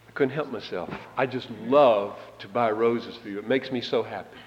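soft, delighted confession; delighted lover. Retold or imitated. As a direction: say it like you truly love giving her roses. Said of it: "let's run it by again".

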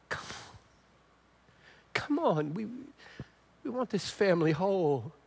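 soft, hushed, loving father's plea; tender plea. Shown as a demonstration. A father softly begging his son to come home, tender and hushed. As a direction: speak it gently, as a father aching for his son. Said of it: "it helps to say something like".

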